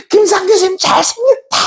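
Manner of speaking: mock cathartic shout; demonstrated yell. Shown as a demonstration. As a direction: holler it as if across the sea. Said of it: "크게 하면 소리치는 거야".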